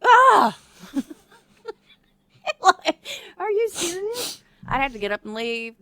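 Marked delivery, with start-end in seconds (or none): 0.0-0.6 loud high shriek of frustration; frustrated scream. Retold or imitated. Wife shrieking at husband's advice, loud and high. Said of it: "And I'm like". 2.6-4.4 high, slow, teary disbelief; exasperated disbelief. Retold or imitated. Wife in disbelief at her husband, high and drawn out. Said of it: "And I'm like".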